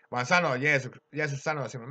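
ordinary talk, nothing marked

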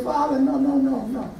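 quiet, gentle protest; soft pleading. Acted. Preacher voicing Jesus pleading with Father, softly.

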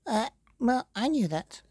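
high, flustered little wolf fibbing. Acted. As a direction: fake it, caught out and squeaky.

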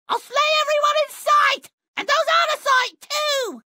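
high, fast shouted threat; furious threat. Acted. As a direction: scream it out in a rage. Villain threatens to slay everyone.

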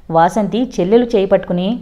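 ordinary delivery

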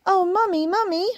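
very high, plaintive kitten voice; put-on high kitten cry; crying little kitten. A narrator's reading. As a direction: wail like a tiny upset kitten. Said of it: "Why are you crying?".

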